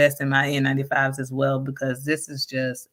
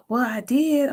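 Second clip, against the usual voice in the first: student protest; 9 semitones higher, slower than usual. Retold or imitated. Student voiced in a story.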